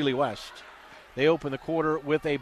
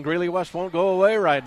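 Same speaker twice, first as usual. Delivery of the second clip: excited; 3 semitones higher, 7 dB louder than usual. Real, not acted. Comeback during live call.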